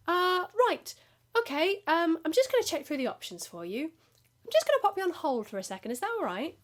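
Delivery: high flustered phone voice; nervous call-centre agent. Retold or imitated. New agent stalling a caller, high and flustered. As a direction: fumble it like a nervous new hire. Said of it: "then you're like".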